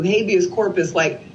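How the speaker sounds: normal talking voice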